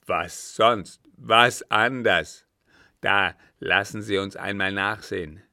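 loud, impatient captain; angry retort. A narrator's reading. Captain answering the doubting count, angry. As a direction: fire back with angry force.

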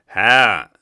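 very loud, thundering bark. Acted. Captain thunders at the German in fury. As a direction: explode with rage. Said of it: "donnerte er".

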